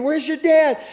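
high, loud frantic cry; screaming. Retold or imitated. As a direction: shout it in panic. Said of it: "She's screaming".

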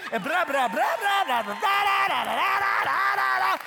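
high, loud stream of nonsense syllables; excited mock chatter. Grandmother gushing on to friend, loud mock babble. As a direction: rattle on at full volume, mimicking excitement. Mimicking her excited testimony running on.

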